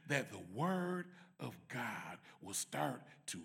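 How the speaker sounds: low, hushed prayer voice, half whispered; soft prayer. Acted. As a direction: pray softly over the congregation, almost breathing it. Leads a closing prayer.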